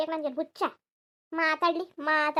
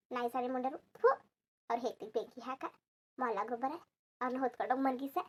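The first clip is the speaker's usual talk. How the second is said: softer, lower cursing under the breath; muttered curses; 3 semitones lower, 7 dB quieter than usual. Acted. Mother-in-law cursing the in-laws under her breath, quiet and low. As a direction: curse them quietly to yourself.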